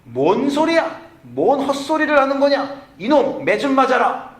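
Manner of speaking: high, loud scolding father; angry patriarch father. Retold or imitated. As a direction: scold the son like a furious old-school father. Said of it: "가부장적인 사회에서 아버지는".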